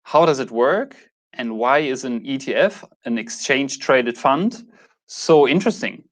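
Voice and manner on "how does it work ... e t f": usual speaking voice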